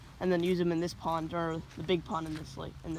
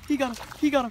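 loud, high, rapid-fire cheer; excited yelling; 7 semitones higher, 5 dB louder, much faster than usual. Real, not acted. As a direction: yell it over the hooked fish. Big bass just hooked.

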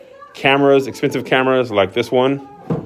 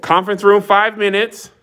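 mock call-out; 8 semitones higher than usual. Calls out as if summoning staff.

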